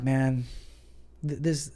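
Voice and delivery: mock-sympathetic, dry; deadpan fake outrage; dryly sarcastic to his wife. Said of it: "I was being sarcastic".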